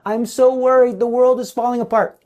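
a hammed-up impression of a worrier, quick at the end; mock worried person. Preacher mimics anxious people who fear for the world, mock fretting. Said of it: "So people who say".

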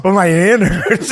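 high, slow whimpering imitation; hammed-up hurt wail; mock pained whine. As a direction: whine it slowly, laughing underneath. Voicing mom with the shattered hand.

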